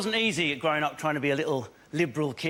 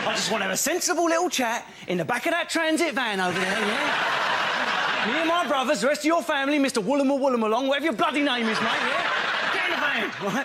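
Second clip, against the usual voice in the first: menacing bloke; 9 semitones higher than usual. Retold or imitated. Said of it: "no one with that face wants".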